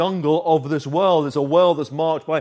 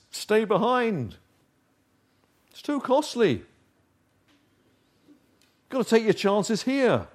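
higher and softer, coaxing; tempting little voice; 5 semitones higher, 6 dB quieter than usual. Acted. The little voice of self tempting the listener to stay, higher and hushed. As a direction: coax them to stay, sweetly and quietly. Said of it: "That little voice says".

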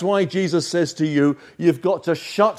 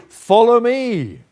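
rescuer's call; 6 semitones higher, 3 dB louder, slower than usual. Acted. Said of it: "And he says to you".

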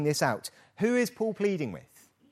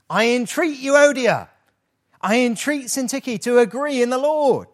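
loud, high, urgent entreaty; passionate plea; 8 semitones higher, 8 dB louder than usual. Acted. Paul entreats the two quarrelling women, loud and urgent. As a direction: beg them with real force. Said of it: "It is passionate".

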